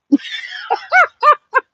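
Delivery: wild shrieking laugh. Real, not acted. Laughs after gloating.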